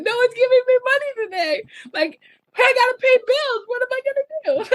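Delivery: a hammed-up teary whimper; mock crying. Retelling her lonely crying over unpaid bills, high wail. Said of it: "like, crying".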